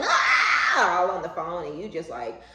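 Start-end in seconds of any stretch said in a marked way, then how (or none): 0.0-1.0 a loud, high mock yell of the caller; imitated yell. Imitating an angry caller yelling on the phone, loud and high. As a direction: yell it like someone ranting down the phone. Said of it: "They yelling".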